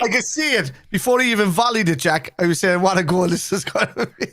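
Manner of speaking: high, breathless thrill; gushing after the goal; excited. Real, not acted.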